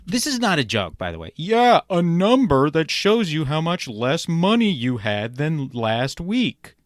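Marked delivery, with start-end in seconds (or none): 1.3-6.8 drawn-out "had than last week" at the end; wordy original punchline. Shown as a demonstration. Critic reading the comic's overlong punchline, dragging its tail. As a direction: drag the tail "had than last week" to show it's too long. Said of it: "You could have just done".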